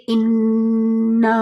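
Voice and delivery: انا held long with a slow nasal ghunna on the doubled نون; nasal ghunna demo. Shown as a demonstration. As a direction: hum the نون of انا through the nose, slowly. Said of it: "ہم اس کو انا یعنی ناک سے ایک آواز نکال". (انا کو ناک سے غنہ کے ساتھ آہستہ کھینچ کر)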